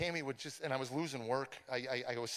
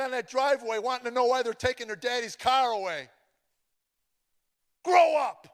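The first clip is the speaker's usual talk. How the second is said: louder and higher, ending in a shouted snap; furious wife; 9 semitones higher, 9 dB louder than usual. Retold or imitated. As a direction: berate him, then explode on the last words. Wife scolding over repossessed car.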